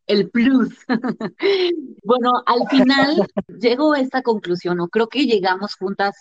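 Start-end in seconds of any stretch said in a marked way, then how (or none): 0.8-2.0 high, light laugh; amused laugh. Real, not acted. Co-host amused by the extra bonus tip, amused laugh. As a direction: laugh at the shared joke. Joking about the extra point.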